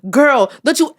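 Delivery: a strict aunt laying down the law; stern aunt. Retold or imitated. Aunt scolds young niece, stern and rising. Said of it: "She said".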